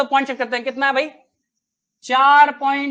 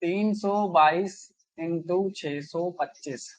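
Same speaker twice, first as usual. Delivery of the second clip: slow dictation; 4 semitones lower, 5 dB quieter, slower than usual. Shown as a demonstration. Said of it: "अंतिम question देखो आज का".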